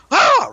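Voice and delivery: sudden very high loud "Ah!"; scare yell. Retold or imitated. Dad scaring son in the car, sudden yell. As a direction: shout it out of nowhere. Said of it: "all I did was go".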